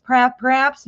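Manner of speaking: ordinary talk, nothing marked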